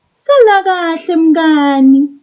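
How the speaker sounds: put-on high, bright child's reply. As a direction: play the young pupil answering. Child puppet says goodbye.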